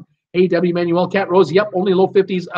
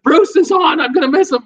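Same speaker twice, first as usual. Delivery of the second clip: high, louder, alarmed gasp of words; mock panic; 11 semitones higher, 6 dB louder than usual. Host voicing a viewer who woke in a rush, mock panic. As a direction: blurt it like you just woke up late. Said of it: "looked at the time and went".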